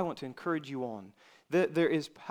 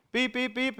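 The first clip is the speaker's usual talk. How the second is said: high-pitched beep imitation; timer beeps; 10 semitones higher, 4 dB louder than usual. Preacher imitating the work alarm for listeners, high beeping. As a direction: mimic short repeated alarm beeps.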